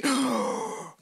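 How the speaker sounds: sharp startled gasp; mock gasp of alarm. Preacher mimicking a worried hypochondriac, sharp gasp. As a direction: gasp at the tiny spot.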